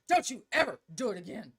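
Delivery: a mock stern warning to her boys; stern scolding. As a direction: scold like a mom mid-spanking. Parent scolding son while paddling.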